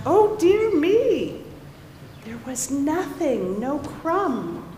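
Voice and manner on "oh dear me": gasp of dismay. Acted. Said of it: "then gasped".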